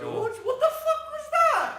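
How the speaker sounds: real laughing shriek; laughing disbelief. Real, not acted. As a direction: crack up at your own slip. Laughing at own slip.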